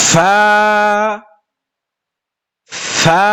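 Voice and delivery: ফা with lip to teeth, a clear f; correct fa. Shown as a demonstration. Teacher showing students the right fa sound. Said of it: "নিচের ঠোঁটের পেটের সাথে লাগবে".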